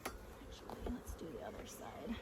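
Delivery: hushed whisper to herself. Real, not acted. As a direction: breathe it out unvoiced. Whispered aside near bees.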